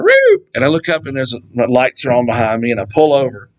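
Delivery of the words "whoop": a very high imitated police siren chirp; siren whoop. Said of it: "all of a sudden I hear".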